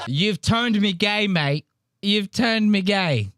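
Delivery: put-on slow, high-pitched wail in Australian accent; mock-distraught Australian. Comic mimics James blaming his mate, mock-distraught Australian. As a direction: draw it out like a stricken Aussie. Mocking the Australian as a lost man.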